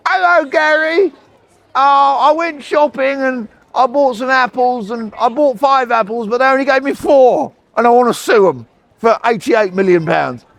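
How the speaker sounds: high-pitched mock caller; silly caller. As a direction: play a daft caller moaning about apples. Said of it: "someone else would call and go".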